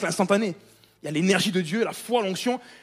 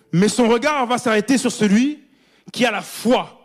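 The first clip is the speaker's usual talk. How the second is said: loud, soaring preacher's voice, drawn out; full-force preaching; 4 semitones higher, 9 dB louder, slower than usual. Acted. Preacher to congregation about Paul, loud fervent preaching. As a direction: proclaim it to the back row.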